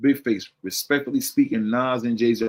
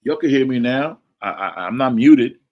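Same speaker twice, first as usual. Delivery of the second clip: louder, higher, checking the connection; calling to check mic; 4 semitones higher, 7 dB louder than usual. Real, not acted. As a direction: call out to see who can hear you. Checking if listeners hear him.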